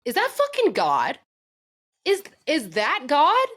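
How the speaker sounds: high, loud, slow astonished gasp of a question; a deer nearly hit, asking if it's God, stunned; stunned disbelief. Retold or imitated. Astonished disbelief. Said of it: "And I go".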